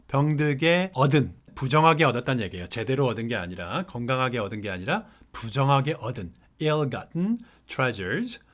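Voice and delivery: usual speaking voice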